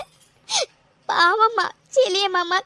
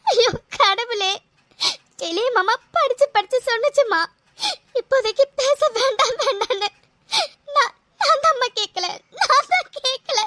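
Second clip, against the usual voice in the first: crying. Acted. Daughter to her mother after her father's refusal, crying. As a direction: break down crying, calling on God.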